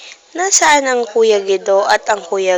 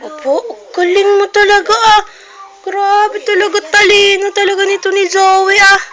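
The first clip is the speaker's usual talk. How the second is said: mock praise; 7 semitones higher than usual. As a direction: gush over the child's cleverness. Praising toddler, higher voice.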